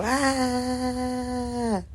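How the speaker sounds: put-on high little baby coo; baby babble. Grandmother imitating toddler's babble.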